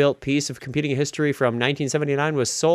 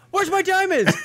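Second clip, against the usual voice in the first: much higher, squeaky outraged crook; high-pitched whiny voice. Retold or imitated. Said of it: "he goes".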